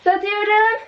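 rising going-going-gone call; auctioneer. Pretend. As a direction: build up to the final sold. Auctioneer counting down the sale.

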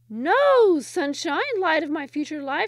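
very high, loud, hooting lovestruck woman; baboon-like call. Acted. Eager bride calls to the Sultan, high and hooting. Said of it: "like the call of a baboon".

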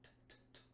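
"t, t, t" as short unvoiced tongue taps; crisp t sounds. Shown as a demonstration. Brass teacher demos clean consonants, crisp whispered taps. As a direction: tap out "t, t, t" with just breath. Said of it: "Clean consonants would be things like T or D".

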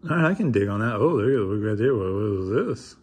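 ordinary delivery

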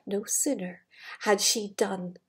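soft, low storytelling hush; hushed suspense. Acted. Reading the transformation to the child, softly hushed.

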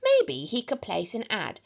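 ordinary delivery

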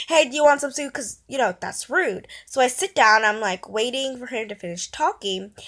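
ordinary talk, nothing marked

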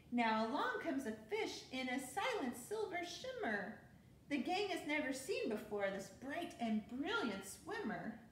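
lively storybook read-aloud; bouncy rhyming picture-book narration; reading to children. A narrator's reading. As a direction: read it like bedtime story time for kids.

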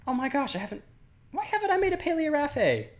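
high-pitched gasp of realisation; excited disbelief. Real, not acted. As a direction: shriek it like you just remembered.

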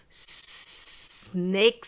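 long hissed s opening snake; snake sound. Shown as a demonstration. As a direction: stretch the s of snake into a long hiss. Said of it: "sound, as I call it".

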